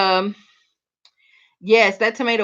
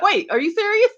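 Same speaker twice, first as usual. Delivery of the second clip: surprised; 13 semitones higher, 3 dB louder than usual. Retold or imitated. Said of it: "I'm like".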